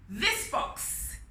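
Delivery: high yelled this box. Acted. Anansi yelling his choice to the king. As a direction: call it out triumphantly. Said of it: "he yelled".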